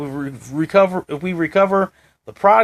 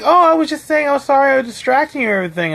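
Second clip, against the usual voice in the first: mock flustered excuse; 9 semitones higher, faster than usual. Imitating coworker's excuse.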